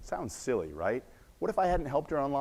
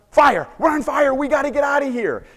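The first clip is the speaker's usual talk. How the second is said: very high, loud panicked shouting; panicking wife; 14 semitones higher, 10 dB louder than usual. Retold or imitated. Wife yells to husband in the burning bus, acted panic. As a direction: shriek the alarm as a terrified wife, hammed up. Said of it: "she's panicking".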